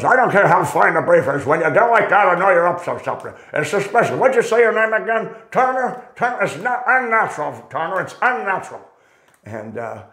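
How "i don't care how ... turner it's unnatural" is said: imitating Vernon's sharp rebuke; stern old master. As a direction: scold the kid like a gruff veteran. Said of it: "and he goes".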